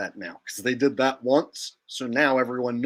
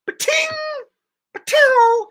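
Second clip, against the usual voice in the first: high, loud ricochet "ba-ting"; ricochet sound effect; 8 dB louder than usual. Shown as a demonstration. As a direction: bullet pinging off metal. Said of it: "it makes the ricochet sound effect".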